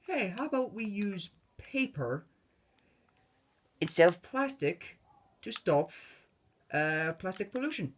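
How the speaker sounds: high-pitched mock government spokesman. Mock government pitching paper over plastic, high put-on voice. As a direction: pitch it up, proposing like a mock official. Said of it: "the government turns around and says".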